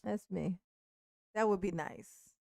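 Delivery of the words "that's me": ordinary talk, nothing marked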